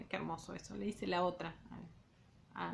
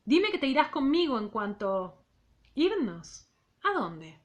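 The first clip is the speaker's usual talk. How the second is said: measured literary read-aloud; reading a novel aloud to students; story narration; 4 dB louder than usual. A narrator's reading. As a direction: read the novel to the class, unhurried.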